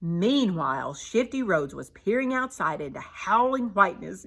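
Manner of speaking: usual speaking voice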